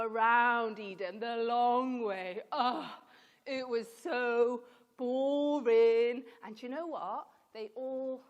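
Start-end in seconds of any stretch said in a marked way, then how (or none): 0.0-6.2 loud, drawn-out groan of boredom; exaggerated weariness. Teacher telling children of a tedious journey, loudly weary. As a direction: drag it out loudly, fed up with the trek. Acting out weary, boring trek.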